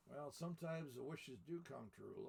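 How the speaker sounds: plain reading voice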